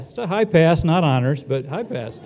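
ordinary talk, nothing marked

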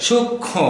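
সূক্ষ্ম with the m sounded as spelt, sukshma; সূক্ষ্ম read letter by letter; wrong pronunciation. Shown as a demonstration. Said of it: "এখানে সূক্ষ্ম বললে হবে না".

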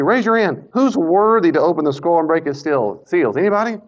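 high, loud summons to the crowd; angel's loud call. Acted. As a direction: challenge everyone to step forward. Angel's challenge voiced by teacher.

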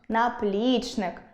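наплічник as наПЛІЧник; наплічник slowly, middle syllable stressed; stress on second syllable. Shown as a demonstration. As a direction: draw out and stress ПЛІЧ in наплічник. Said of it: "спробуйте вимовити голосніше кожен з них".

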